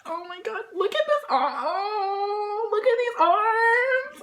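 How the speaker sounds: very high, loud, slow gush; swooning squeal. Real, not acted. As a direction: swoon over the arms. Swooning over photos.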